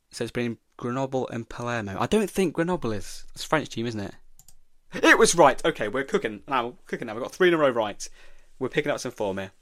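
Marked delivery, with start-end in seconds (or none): 5.0-5.6 real, high, loud burst of triumph; excited cheer. Real, not acted. Quiz player learns his guess was right, excited. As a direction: celebrate a surprise correct answer loudly.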